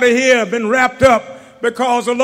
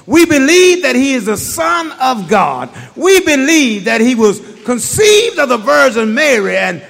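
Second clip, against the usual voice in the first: rising, shouted creed, louder line by line; preaching at full force; 4 dB louder than usual. Real, not acted. Preacher to his congregation, shouted rising creed. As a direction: preach it, stacking each we believe.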